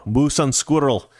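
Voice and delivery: slow, exaggerated Russian accent on "Moose and squirrel". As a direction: drag it out like a cartoon Russian villain. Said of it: "I think I do a better Russian accent".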